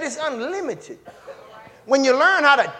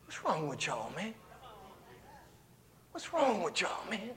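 soft rebuke; 5 semitones lower, 19 dB quieter than usual. Real, not acted. Preacher scolding softly.

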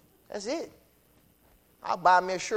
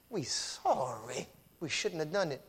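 remorseful brothers; 3 semitones lower, 8 dB quieter than usual. Retold or imitated. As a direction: quietly admit the wrong, head bowed. Brothers repenting, voiced.

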